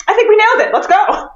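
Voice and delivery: high-pitched, pleased-with-yourself cheer; mock triumphant. Both actors celebrate their failed British accents, mock triumphant. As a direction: imitate two buddies thrilled with themselves. Mimicking duo proud of bad accents.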